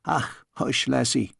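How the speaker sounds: put-on calm her like a fond old nurse; soft Scottish comfort; soothing Scots nanny.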